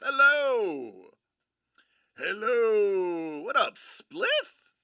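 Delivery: loud, stretched-out hello; excited raid welcome; hollered greeting. Real, not acted. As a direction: call out high and loud to new arrivals. Calling out greetings to raiders.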